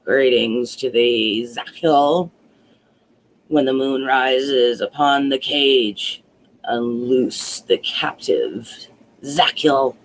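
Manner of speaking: low, slow droning incantation; old witch chanting a spell to free Joringel. Acted. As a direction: drone it like a witch casting a spell. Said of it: "said in a droning voice".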